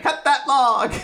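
high, loud playful order; bossy little girl. Retold or imitated. Podcast host acting out tiny Ginny ordering Kuma, mock bossy shout. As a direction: bark it like a bossy kid sister. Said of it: "her being like".

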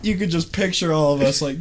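normal talking voice

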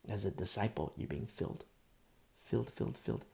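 hushed, letting it sink in; quiet. Real, not acted. Preacher hushing the congregation, near whisper. Preacher drops to soft intimate tone.

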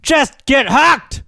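high, drawn-out exasperated yell; mock yelling. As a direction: holler desperately at an uncooperative fish. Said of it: "what's with all the yelling".

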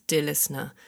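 ordinary talk

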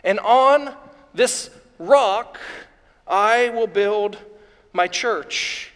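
slow and deliberate, dropping at the end; solemn quotation. Acted. Preacher repeats Jesus' promise to Peter, slow and weighty. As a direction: space out each phrase with weight.

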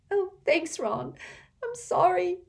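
put-on high, teary girl's voice; sniffling thanks through tears; a young girl sniffling an apology; tearful Hermione. A narrator's reading. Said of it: "She blew her nose and hiccuped".

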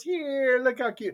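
put-on high little-girl voice; little daughter. Little daughter showing dad her doll, proud. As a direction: show off a doll in a child's high voice. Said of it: "And she goes".

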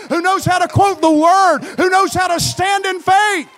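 loud, driving sermon climax; full-force preaching. Real, not acted. Preacher calling for faith-filled people, full-force preaching. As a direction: build insistently to a high, shouted peak.

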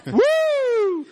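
high, loud whooping woo; retold woo. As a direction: yelp it like a rowdy guy on a train. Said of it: "this dude was on there going".

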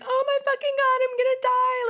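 very high mock terror; high-pitched mock panic; scared. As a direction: squeal like someone terrified of bats. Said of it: "she is so scared of bats".

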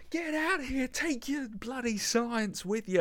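high, strained mock yell; imitated screaming. Crew throwing the scientist out, imitated high screaming. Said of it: "the screaming behind it".

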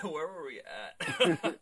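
words squeezed out through laughter; slow, giggling question; laughing. Real, not acted. Laughing fit after bathroom joke.

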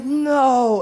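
high, loud panicked "No."; alarmed cry. Retold or imitated. Father runs to daughter.

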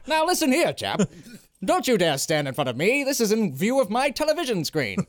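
mock old-time gentleman, indignant; nineteen-thirties gentleman. Gentleman objects to someone blocking his television, mock 1930s voice. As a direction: put on an affronted 1930s gentleman. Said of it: "sounding like gentlemen in the nineteen thirties".

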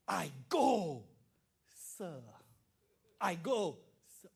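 bow and say it with respect; a dutiful son answering his father; respectful son. Retold or imitated. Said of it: "so honoring, so much respect".

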